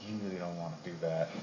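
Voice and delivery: low, hushed, quick warning; calm her man down under her breath; quiet warning. Retold or imitated. Girl warns him quietly.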